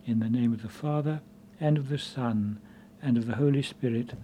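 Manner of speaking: soft, measured opening prayer. Acted. Priest blessing the congregation, soft prayer tone. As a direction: intone the blessing quietly.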